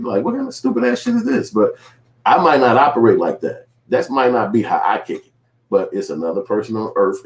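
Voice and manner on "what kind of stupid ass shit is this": much higher, mock disbelief; incredulous. The narrator reacting to his rude friend, high and incredulous. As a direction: throw up your hands in mock disbelief.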